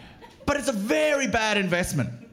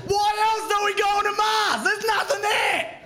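ranting shout; 9 semitones higher than usual. Acted. Comic yelling at the audience about Mars, high shout. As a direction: bellow it at the crowd.

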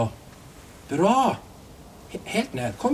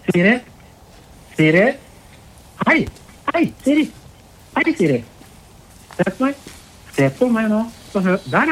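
loud, insistent appeal; raised, commanding but controlled voice; urgent calling out; 5 dB louder than usual. Real, not acted. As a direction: call out to grab and hold her attention. Calling to hold distressed woman's attention.

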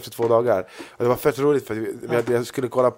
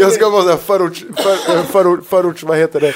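excited; 7 semitones higher, 9 dB louder than usual. Real, not acted. Excited, searching for a word.

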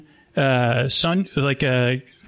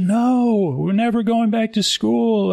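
whiny mock outcry, pitched way up; rebellious kids; 8 semitones higher than usual. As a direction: imitate a crowd of defiant children. Kids refusing to go home.

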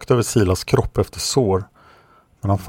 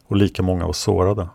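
ordinary delivery, nothing marked

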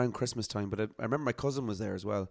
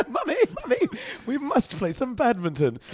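put-on posh Kerry accent; 13 semitones higher, 4 dB louder than usual. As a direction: whine like a posh Kerry child. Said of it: "That Kerry accent is flawless".

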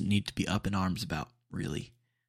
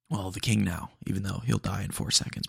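ordinary talk, nothing marked

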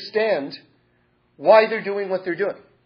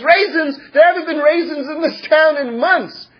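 much higher, louder shocked shout; outraged husband; 9 semitones higher, 7 dB louder than usual. Acted. Poor husband yells at his wife about the cost of cinnamon, outraged. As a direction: shout it as if robbed.

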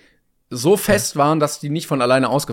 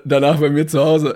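usual speaking voice

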